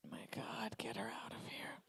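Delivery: breathless aside whisper. Acted. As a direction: mutter it under your breath. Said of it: "aside whisper".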